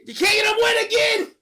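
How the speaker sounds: high, loud mock fury; exasperated yelling. Viewer scolding the dad's pet plan, exasperated mock yell. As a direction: scream it in comic disbelief. Teller yells at the dad.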